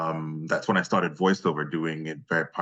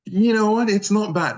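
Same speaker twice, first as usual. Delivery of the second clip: high, loud burst of enthusiasm; much higher, louder eager reply; excited; 6 dB louder than usual. Real, not acted. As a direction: jump up with eager delight. Answering question, excited.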